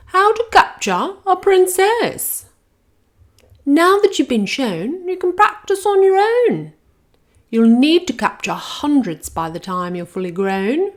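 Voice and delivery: a grand schoolmistress dragon instructing her class; high loud dragon teacher voice; Madam Dragon. Acted.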